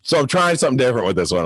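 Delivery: usual speaking voice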